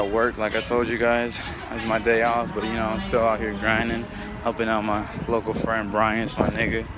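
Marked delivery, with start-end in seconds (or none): none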